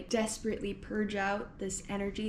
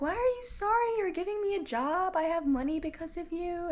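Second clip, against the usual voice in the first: cheerful hotel worker; 9 semitones higher than usual. Retold or imitated. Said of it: "And the guy was like".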